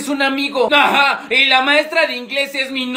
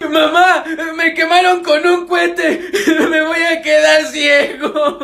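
hammed-up wailing; 3 semitones higher, slower than usual. Burned kid at a posada crying to his mother, exaggerated wail. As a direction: wail for mom, dragging out the end.

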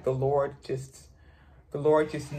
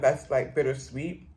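words between bites; talking while chewing. Real, not acted. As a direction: mouth partly full of food. Seen 3: covers mouth earlier, lips pressed chewing 1.1-2.2s while forking food.

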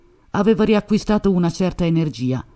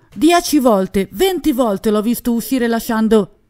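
still shouting; 6 semitones higher than usual. A narrator's reading. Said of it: "urlò letteralmente Jean Chabot".

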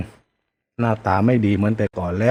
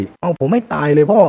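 bitter dare; 6 semitones higher than usual. Acted. Son keeps daring his father.

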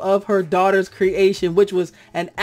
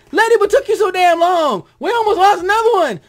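much higher, loud exasperated yell; scolding shout; 13 semitones higher, 8 dB louder than usual. Retold or imitated. Vlogger scolding Letty at the screen, loud exasperated yell. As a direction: chew her out for being late. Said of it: "and I'm sitting there going".

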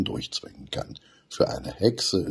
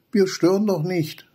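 much higher, louder insistence; eager kid voice; 13 semitones higher, 5 dB louder than usual. A narrator's reading. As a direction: brush off her worry. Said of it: "sagt Benny". (hohe Kinderstimme, drängend)

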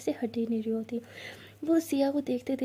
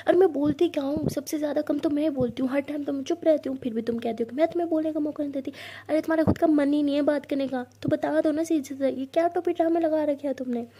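louder indignant rant; angry rant; 3 semitones higher, 4 dB louder than usual. Acted. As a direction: keep up the angry tirade. Angry girlfriend scolding.

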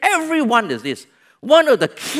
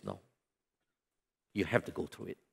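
much softer, lower solemn refusal; quiet, heavy divine no; hushed voice of God; 13 semitones lower, 19 dB quieter, much faster than usual. Acted. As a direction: drop to a grave hush.

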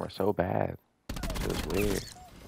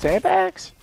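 very high, loud one-word question; incredulous; 8 dB louder than usual. Real, not acted. Streamer disputing the head glitch claim, incredulous. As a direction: squeal it back in loud disbelief.

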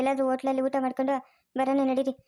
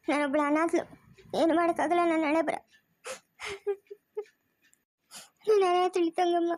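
crying lament; 2 semitones higher than usual. Acted. Woman crying to her mother that she feels orphaned.

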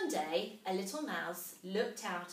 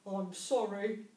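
mumbling mole; 4 dB louder than usual. A narrator's reading. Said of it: "mumbled Mole".

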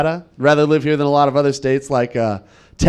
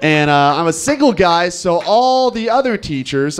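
ordinary delivery

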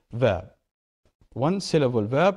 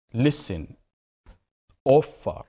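LIS in "Listen" and OF in "offer" stressed; stress on first syllable; slower than usual. Shown as a demonstration. Teacher demonstrating verb stress, "Listen, offer" stressed on first syllables. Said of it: "The first syllable".

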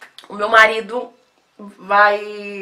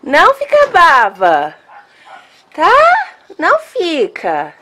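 soothing pet talk; 4 semitones higher, 5 dB louder, slower than usual. Real, not acted. Soothing the upset dog.

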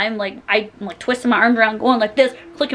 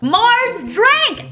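excited announcement; 12 semitones higher than usual. Acted. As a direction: hype it up like a show host. Excited reveal in clip.

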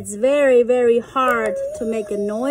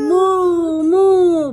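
louder playful moo; cow moo; 5 semitones higher, 6 dB louder than usual. Pretend. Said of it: "All the wonderful sounds".

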